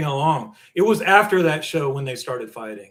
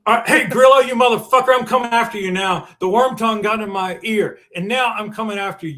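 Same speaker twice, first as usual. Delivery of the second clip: loud, higher call-out to an absent rival; mock threat; 5 semitones higher, 5 dB louder than usual. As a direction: fire off a loud mock warning. Mock threat aimed at Grillo.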